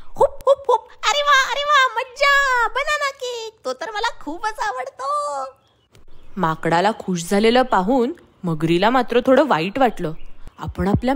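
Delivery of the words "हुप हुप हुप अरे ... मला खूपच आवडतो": high, giggly overjoyed monkey; a monkey squealing over banana cake; delighted monkey laughing as he hoots; happy monkey. Acted. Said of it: "माकडोबा खुश झाले".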